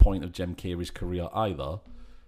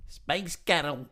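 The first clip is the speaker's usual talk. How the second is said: Glaswegian accent; 6 semitones higher, much slower than usual. Shown as a demonstration. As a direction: call it out in a thick Glaswegian accent. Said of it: "sounds like a Glaswegian going".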